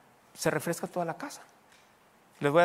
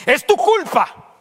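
much higher, much louder, slow accusing yell; the devil; 12 semitones higher, 13 dB louder, much slower than usual. Acted. Devil blaming the believer, a slow loud yell. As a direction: spit out the blame like a demon. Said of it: "Ese es el diablo".

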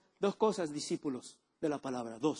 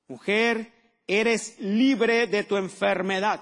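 louder, emphatic scripture reading; preaching scripture; 7 dB louder than usual. Acted. Pastor reads Lucas thirteen to the church, loud preaching. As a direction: proclaim the passage to the congregation.

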